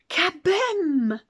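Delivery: explosive kaboom, high and loud. A narrator's reading. Narrator voicing the explosion, loud kaboom. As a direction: burst out with the blast.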